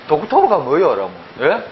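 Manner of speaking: ordinary delivery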